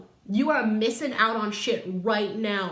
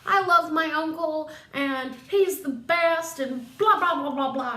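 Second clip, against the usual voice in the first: higher and louder, hammed-up adoring insistence; mock gushing nephew; 7 semitones higher, 5 dB louder than usual. Nephew defending his uncle to the family, hammed-up gushing. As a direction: gush over your uncle like a superfan, played for laughs.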